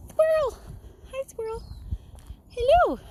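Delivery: very high cutesy pet-talk; high-pitched sing-out to a critter; calling a squirrel. Real, not acted. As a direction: coo high to a small animal.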